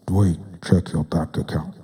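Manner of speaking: low, booming voice of God; deep God voice. Acted. God telling Dwayne to check his account, low and booming. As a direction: speak as a deep divine voice. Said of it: "to do God's voice like that".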